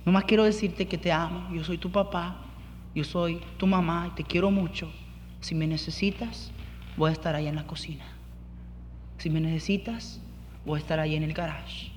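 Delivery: put-on calm a hurting teen softly; quiet loving father voice; gentle parent. Voicing gentle parent comforting son.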